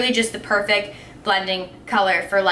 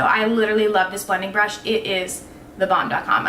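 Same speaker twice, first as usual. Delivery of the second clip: ordinary talk, nothing marked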